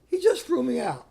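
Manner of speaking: high, loud retold disbelief; reliving the shock, voice jumping up; shocked protest. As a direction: protest in astonishment. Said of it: "I'm gonna say the shock".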